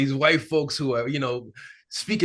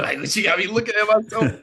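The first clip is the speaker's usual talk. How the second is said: laughing; 8 semitones higher, 4 dB louder than usual. Real, not acted. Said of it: "I'm over here laughing".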